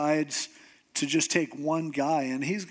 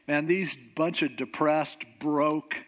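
ordinary delivery

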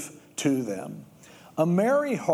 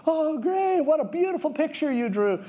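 much higher, sing-song delight; gushing praise; 11 semitones higher than usual. Retold or imitated. Parent to a little kid showing a drawing, gushing.

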